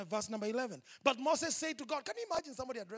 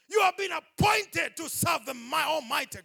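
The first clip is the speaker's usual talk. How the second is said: loud, rising preacher's cry; preaching at full force; 5 semitones higher, 11 dB louder, slower than usual. Real, not acted. Preacher declaring to the congregation, loud and fervent. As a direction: proclaim it to the church with full force.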